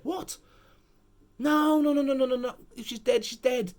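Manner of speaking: high, loud cries of no, no; outraged disbelief. Real, not acted. Vlogger ranting at the writers, outraged disbelief. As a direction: protest like a betrayed fan.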